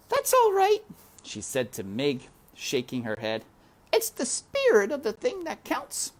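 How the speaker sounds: very high, gentle reassurance; kind princess. A narrator's reading. Princess comforting the fallen maid, high and gentle. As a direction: reassure her kindly.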